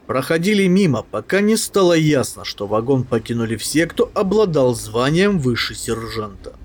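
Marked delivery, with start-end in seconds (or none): none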